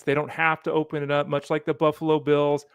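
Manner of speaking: ordinary talk, nothing marked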